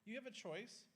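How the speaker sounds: bright, high and positive; upbeat. Shown as a demonstration. Parent offering a child a choice, upbeat. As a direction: lift the pitch and sound cheerful. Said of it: "tell them in a kind of an upbeat manner and go".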